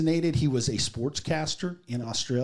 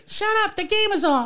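very high, put-on voice imitating her; mocking her voice. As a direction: redo it as her, shrill and mocking. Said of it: "Oh, I'm sorry. She said".

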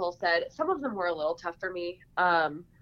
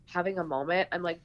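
ordinary delivery

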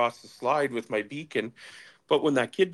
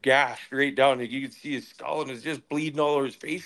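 ordinary talk

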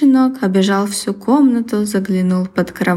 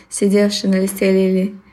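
ordinary delivery

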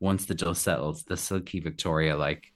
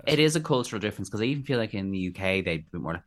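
normal talking voice

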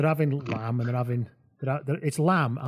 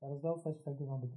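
put-on stuck Irish accent; 14 dB quieter, faster than usual. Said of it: "I'm locked in the Irish one now".

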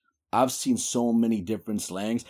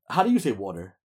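ordinary talk

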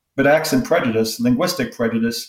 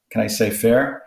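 ordinary talk, nothing marked

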